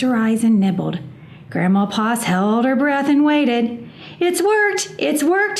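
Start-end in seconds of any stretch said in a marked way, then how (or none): 4.2-5.6 high excited exclamation; joyful cry. A narrator's reading. Grandma Poss seeing Hush visible, high and joyful. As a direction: cry out with joy. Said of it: "she cried".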